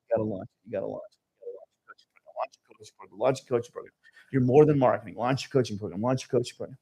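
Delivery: hushed, low inner voice, fading toward a whisper; a hushed inner voice urging him to launch. Retold or imitated. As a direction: murmur it like a thought in your head. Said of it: "this little voice in my head saying".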